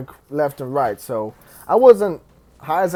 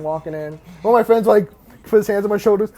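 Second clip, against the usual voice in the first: ordinary delivery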